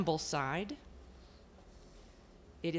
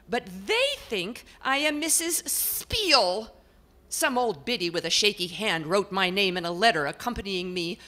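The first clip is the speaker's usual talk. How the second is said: portrait lady; 3 semitones higher, 5 dB louder than usual. Acted. Portrait complains to the house about her people. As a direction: complain at length, put-upon. Portrait explains the misread name.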